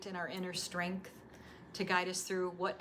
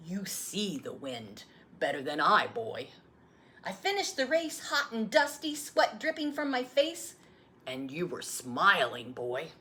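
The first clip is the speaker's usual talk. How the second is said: a patient grandfather answering; grandfather's voice; 6 dB louder than usual. Acted. Grandfather replies.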